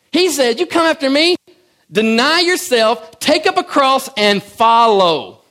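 loud, high preaching; fiery pulpit preaching; full-force preaching. Acted. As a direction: drive the sermon home at full volume.

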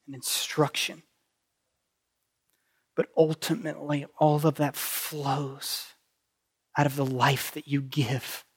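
hushed pastoral prayer. Acted. Pastor leads closing prayer.

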